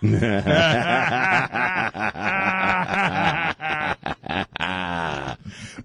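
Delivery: real helpless laughter; long belly laugh. Real, not acted. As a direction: crack up and keep laughing. Cracks up at the joke.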